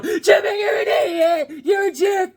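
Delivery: put-on high, loud kids yelling across yards; imitating bullies shouting; yelled taunts. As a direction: holler the insults from two yards over. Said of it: "they're still going".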